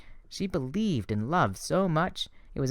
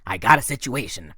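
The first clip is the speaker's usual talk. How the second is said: louder, taunting bully voice; Ares, menacing war god; 7 dB louder than usual. A narrator's reading. War god threatening Percy outside the limo, louder and taunting. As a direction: lean in and threaten the kid. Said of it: "he said".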